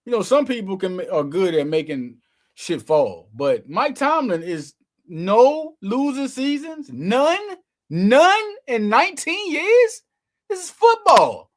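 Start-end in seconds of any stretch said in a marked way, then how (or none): 3.7-11.5 building higher and louder, slow; rising to a big proclamation; climbing hype, slow and emphatic; hyped up. Real, not acted.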